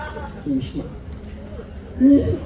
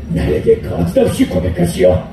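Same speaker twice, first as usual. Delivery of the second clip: stern husband. Acted. Angry husband voiced low.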